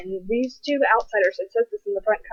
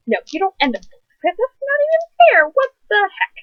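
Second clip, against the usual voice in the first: high, loud mock outrage; hammed-up indignant outburst; outraged reader; 11 semitones higher, 5 dB louder than usual. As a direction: protest the unfair ending, voice shooting up. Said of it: "I threw it across the room".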